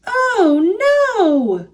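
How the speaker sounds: high, drawn-out cry of dismay; dismayed exclamation. A narrator's reading. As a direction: exclaim in alarm, starting high and falling. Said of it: "Thomas exclaimed".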